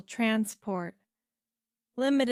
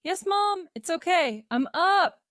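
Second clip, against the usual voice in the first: loud, high reply through a door; shouted back; 9 semitones higher, 7 dB louder than usual. A narrator's reading. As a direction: call back that he's awake. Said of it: "Peter shouted back through the door".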